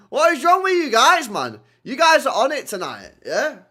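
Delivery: high, loud disbelieving shout at chat; loud exasperated outburst. Real, not acted. Streamer shouting at angry chat, loud and exasperated.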